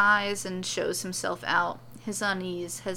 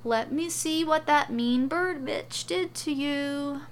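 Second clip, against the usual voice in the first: put-on higher, cooing baby talk; a higher baby-talk coo to a wounded man; 7 semitones higher than usual. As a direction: coo at him like a hurt toddler. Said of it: "saying in a babying voice".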